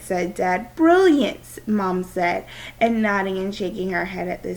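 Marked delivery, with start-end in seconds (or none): 0.8-1.7 excited praise. Acted. Mom echoing dad's praise.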